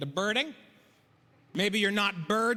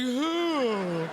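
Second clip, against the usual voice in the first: long, swooping owl-like hoot; 5 semitones higher than usual. Acted. Comic fooled by the owl joke, long owl hoot. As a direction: hoot it as if flapping wings. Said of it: "I'm pretty sure I flapped a little bit".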